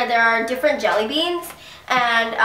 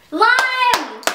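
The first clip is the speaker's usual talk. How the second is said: excited; 10 semitones higher than usual. Real, not acted. Relieved at good flavor.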